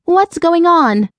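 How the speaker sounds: high, drawn-out anxious question; high-pitched, slow alarm. A narrator's reading. Frightened woman demands an explanation.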